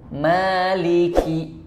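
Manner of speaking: slow Maliki with breathy hiss on ki; hamas on ki. Shown as a demonstration. Teacher demonstrating hamas on Maliki. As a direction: let air hiss out on the ki of Maliki. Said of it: "jangan lupa hamas ya".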